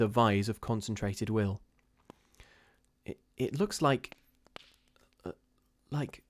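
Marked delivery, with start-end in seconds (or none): 3.0-6.2 horrified stammer. A narrator's reading. Missus Brigham facing the shadow, stammering in horror. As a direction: falter in horror, unable to finish. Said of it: "stammered Missus Brigham in a tone of intense horror".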